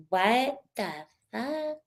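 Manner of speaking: slow, soft, stunned mock disbelief. Imitating Whitney arriving, slow and quietly baffled. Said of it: "she's like".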